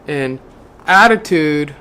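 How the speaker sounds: measured inspirational book reading; reading aloud. Acted. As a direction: read it like a devotional passage to the listener. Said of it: "I did a great job reading".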